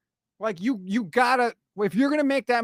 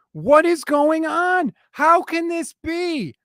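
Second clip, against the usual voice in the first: higher, louder, slow cries of outrage; exasperated disbelief; 6 semitones higher, 4 dB louder, slower than usual. Real, not acted. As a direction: demand answers from the team, stretched out.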